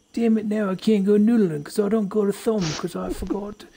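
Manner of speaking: put-on over-the-top Australian accent ending on mate. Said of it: "Accents are too easy".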